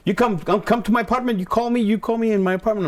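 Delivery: put-on high-pitched elderly Indian caller; strong Indian accent. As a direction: imitate an insistent Indian man on the phone. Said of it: "he had a real strong accent".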